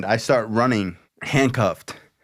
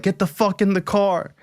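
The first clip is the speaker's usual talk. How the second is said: imitating an urgent, higher-pitched order; exasperated brother; 8 semitones higher than usual. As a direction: act out a frantic brother's command. Brother acted, grabbing him by belt.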